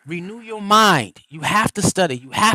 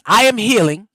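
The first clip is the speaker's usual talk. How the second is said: louder, higher declaring; strong affirmations for the listeners; 4 semitones higher, 6 dB louder than usual. Shown as a demonstration. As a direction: proclaim each I am with conviction.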